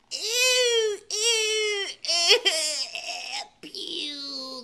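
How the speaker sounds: high, fading cries of disgust; disgusted howl. Acted. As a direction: wail it, then fade out. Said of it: "they howled in horror".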